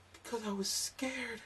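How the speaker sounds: put-on high, slow girly voice; small scared admission; timid girlfriend. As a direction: girlfriend explaining why she kept quiet. Girlfriend explaining her silence.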